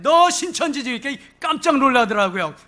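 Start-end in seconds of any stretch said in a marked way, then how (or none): 0.0-0.9 high-pitched pouncing challenge; re-enacted accusing outburst; sudden accusation. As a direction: cut in high, startling the visitor.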